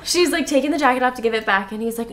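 usual speaking voice

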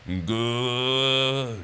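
very long, slow goodbye; dragged-out word. Real, not acted. Host signing off the episode, dragged-out goodbye. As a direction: stretch each syllable of the farewell right out. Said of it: "I can drag these words out".

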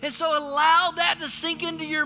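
preaching at full force. Real, not acted. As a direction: preach the cross to the church.

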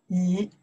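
椅 drawn out in a low dipping third tone; 椅 as an isolated third-tone demo. Shown as a demonstration. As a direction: let 椅 dip then rise. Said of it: "third tone".

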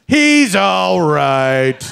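condescending. Retold or imitated. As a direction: talk down to a scared stranger, stretching every word. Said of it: "People would say this super condescending".

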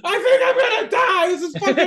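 very high, loud hammed-up terror; mock panicked scream. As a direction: shriek it as if the ride will kill you. Acting out his screaming on the ride.

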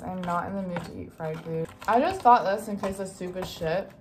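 ordinary talk

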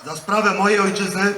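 loud, measured oath line; formal oath dictation. Real, not acted. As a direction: declaim each phrase for the ranks.

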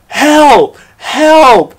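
loud, high cry of help; calling for help. Retold or imitated. Teen in a teacher's headlock calling to classmates, loud high cry. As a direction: yell for help as if truly grabbed.

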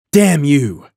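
stung, indignant outburst; angry cry. A narrator's reading. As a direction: cry it out in anger, pitch jumping up. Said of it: "she cried".

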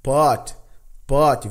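pot said with open short o; English pot. Shown as a demonstration. Teacher models pot for students. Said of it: "o desenho fonético das quatro primeiras pronúncias".

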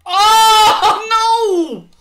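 high, loud laughing cry of despair; laugh-cry wail. Real, not acted. Gamer seeing a shot go in off the post, laughing wail. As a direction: wail it in disbelief.

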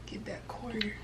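whispered aside, unvoiced. Real, not acted. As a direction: whisper it under your breath. Whispers during filming.

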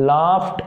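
"Laughed" said as "laft"; t-sound pronunciation. Shown as a demonstration. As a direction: finish "Laughed" with a clear T. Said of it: "టీ అనే sound వచ్చే విధంగా".

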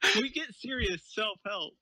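usual speaking voice

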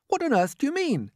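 high, incredulous demand; crying out. A narrator's reading. Everyone questioning the ladybird, high astonished cry. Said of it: "they cried".

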